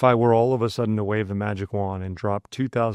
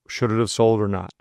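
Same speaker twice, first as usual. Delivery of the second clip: usual speaking voice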